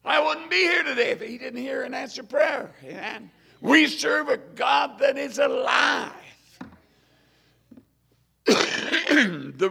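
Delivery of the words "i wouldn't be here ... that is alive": full-force sermon shout; fiery preaching. Acted. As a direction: preach it out to the congregation.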